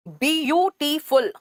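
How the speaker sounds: "Beautiful" slowly as bi-yu-ti-ful; broken into syllables. Shown as a demonstration. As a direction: say "beautiful" one syllable at a time. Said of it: "खंडों में जब तोड़ के उसको बोलते".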